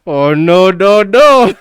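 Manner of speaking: imitating a viral "oh no"; meme imitation. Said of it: "I forgot all about that meme".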